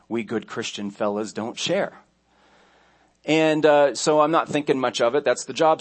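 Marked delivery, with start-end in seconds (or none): 0.1-1.9 a hint of Southern good-fella voice; Southern accent. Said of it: "put the southern accent on it".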